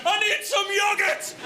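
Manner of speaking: high, slow, laughing yell; desperate shout. Retold or imitated. Desperate mate yelling in restaurant.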